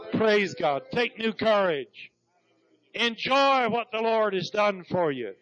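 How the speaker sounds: rally the congregation with fervour; fired-up preacher urging the church to rejoice; preaching at full force. Real, not acted. Exhorting congregation to celebrate.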